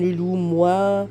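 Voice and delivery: ordinary talk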